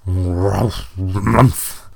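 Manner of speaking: long, growling bear grunt. A narrator's reading. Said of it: "said the bear".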